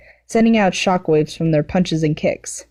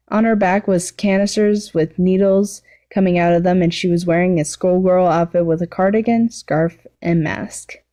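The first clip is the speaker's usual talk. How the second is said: ordinary delivery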